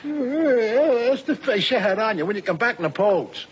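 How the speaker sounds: ordinary delivery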